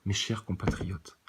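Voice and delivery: voix de politicien lente et basse. Shown as a demonstration. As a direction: Mes chers compatriotes, lentement, à voix basse. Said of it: "je vais vous parler comme un politicien". (ton solennel de discours présidentiel)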